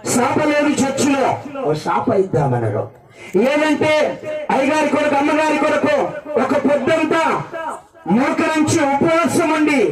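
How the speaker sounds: high-pitched preacher's cry; forceful preaching. Real, not acted.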